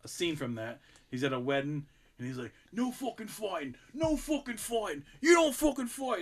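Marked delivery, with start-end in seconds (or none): 2.7-6.2 a hint of Irish English mobster, rising in pitch; mock Irish English gangster laying down the law; imitating Irish English accent. Retelling a Peaky Blinders wedding scene, light Irish English accent. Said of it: "Joe doing an Irish English accent".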